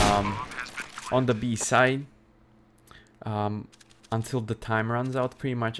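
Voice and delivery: ordinary delivery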